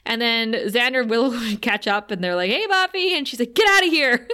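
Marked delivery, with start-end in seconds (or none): 2.5-3.1 high, sing-out mock greeting; friendly call. Friends catch up with Buffy, high mock greeting. 3.5-4.3 louder, high mock shout; urgent warning. Buffy warns friends off near Dracula, mock shout.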